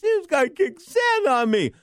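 put-on high, slow little-kid voice; a kid tattling to his dad in a high whine; kid's whine. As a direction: squeak it out like a hurt child. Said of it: "He said".

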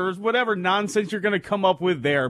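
ordinary delivery

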